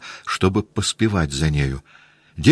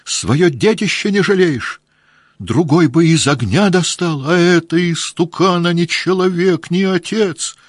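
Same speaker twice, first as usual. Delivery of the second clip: high, loud furious reproach; angry shouting; 11 semitones higher, 4 dB louder than usual. A narrator's reading. Wife screams at her husband for abandoning their child, furious. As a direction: rail at him with rage. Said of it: "злобно закричала женщина".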